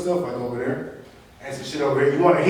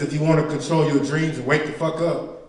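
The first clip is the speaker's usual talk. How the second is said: normal talking voice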